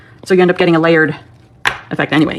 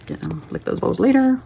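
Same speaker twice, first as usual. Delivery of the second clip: whispering; 7 dB quieter than usual. Real, not acted. Quiet aside while working.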